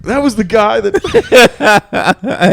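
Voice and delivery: high, loud, drawn-out words through laughter; laughing. Retold or imitated. Co-host jokingly passing on blame, laughing hard. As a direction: crack up while pointing the blame.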